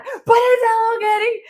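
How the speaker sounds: high, squealed fan gushing; acting out starstruck glee; retold giddy excitement. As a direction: squeal it like you can't help yourself. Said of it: "And I was so excited".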